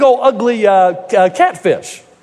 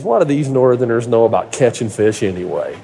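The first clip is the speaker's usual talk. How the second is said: put-on lower, scoffing old Tennessee dad; scoffing hillbilly dad; 12 semitones lower, 3 dB quieter than usual. Hillbilly dad scoffing to his son about Northern fishermen, lower. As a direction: drop the voice low and scoff at Northerners. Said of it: "My dad looks at me and says".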